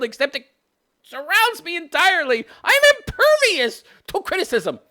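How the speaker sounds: high, whiny mock self-pity; hammed-up high whine; triggered whiner. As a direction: play a put-upon crybaby. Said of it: "he just got triggered".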